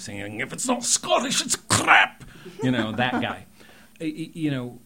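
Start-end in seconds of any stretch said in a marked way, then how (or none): 0.4-2.2 high, loud mock Scottish shout; Scottish accent. Imitating the SNL guy, loud Scottish accent. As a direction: belt it like a ranting Scotsman in a Scottish accent. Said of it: "that Scottish accent from the guy on Saturday Night Live".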